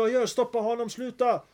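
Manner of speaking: alarmed companions shouting him down; agitated protest. Retold or imitated.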